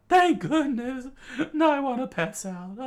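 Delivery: high loud voice, relieved; exhausted relief; relieved shout. Acted. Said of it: "Chikara screamed out".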